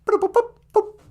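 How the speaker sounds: high chirpy robotic beeping; computer beeps. Pretend. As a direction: beep like an old computer.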